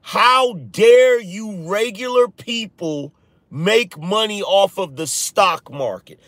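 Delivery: imitating Wall Street types scolding regular people; mocking elitist outrage. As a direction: sneer it like an offended elite. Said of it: "all I heard was".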